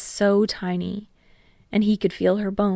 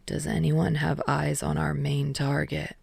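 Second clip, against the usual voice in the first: lightly voiced, softer interjection; Shinso interjecting; 3 semitones lower, 5 dB quieter than usual. A narrator's reading. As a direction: quietly cut in to refocus the team. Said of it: "Shinso interjected".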